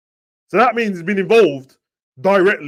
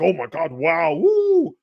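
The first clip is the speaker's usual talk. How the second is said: mock over-the-top cheering; slower than usual. Mocking Ramsdale's over-the-top clapping for his teammate, hammed-up cheer. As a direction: ham up a keeper's fake celebration. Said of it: "Ramsdale did the over the top clapping".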